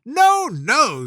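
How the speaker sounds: a queen cutting in sharply; imperious queen. A narrator's reading. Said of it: "said the queen".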